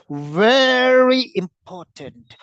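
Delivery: "very" drawn out long and high; stress on VERY. Shown as a demonstration. Teacher showing students sentence stress, VERY stretched and emphasized. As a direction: lean hard on "very", stretching it slowly. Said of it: "Sentence stress".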